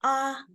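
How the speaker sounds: "ah" held high and level; one high-pitched, even "ah"; first tone. Shown as a demonstration. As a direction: sustain "ah" up high without any slide. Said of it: "It's high-pitched".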